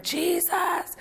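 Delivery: high cried-out name, retold; hollering Jesus. Crying out for help inside the car, high call. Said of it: "So I start hollering".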